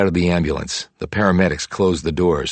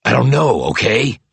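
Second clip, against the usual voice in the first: loud, sharp bark, raised pitch; 6 semitones higher, 4 dB louder than usual. Acted. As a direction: snap back in frustration, louder. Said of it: "barked Roby".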